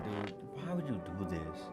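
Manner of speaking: ordinary talk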